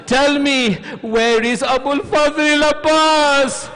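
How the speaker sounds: high, loud cries, easing at the end; anguished lament. Retold or imitated. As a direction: cry out with a preacher's grief. Preacher voices anguished exchange over Abbas.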